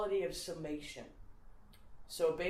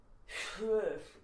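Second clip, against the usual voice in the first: imitated involuntary puff of air; escaped breath noise; 4 semitones higher than usual. Retelling Louis C.K.'s coffee shop bit, escaped breathy noise. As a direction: let it escape like a wordless breath. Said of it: "escaped out of him".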